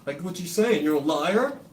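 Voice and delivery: high, loud incredulous voice; mock laughing stranger. Strangers in public mocking him, high and loud. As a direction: jeer like a scoffing bystander. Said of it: "people laugh in public".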